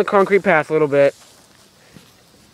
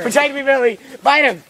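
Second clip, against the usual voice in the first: mock panic; 8 semitones higher than usual. Urging turtle to fight.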